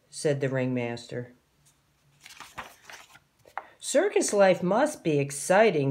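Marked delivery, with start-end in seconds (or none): none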